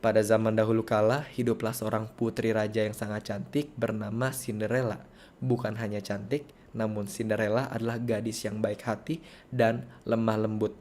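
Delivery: soft, low monotone reading; dull storytelling. Shown as a demonstration. Teacher showing storytelling that bores children away, dull monotone. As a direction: drone through the fairy tale without any interest. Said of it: "kita bawakannya seperti ini".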